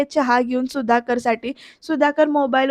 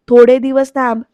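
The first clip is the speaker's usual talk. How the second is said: the louder second take, insisting; annoyed husband pressing the point louder; irritated, louder; 8 dB louder than usual. Retold or imitated. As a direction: repeat it louder and harder. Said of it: "सुधाकरची चिडचिड होतीये".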